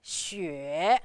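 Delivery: XUE low and dipping, rising at the end; third tone. Shown as a demonstration. Teacher repeating xue after the rule, deep dipping tone. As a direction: take xue down, then up. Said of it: "going down and then up".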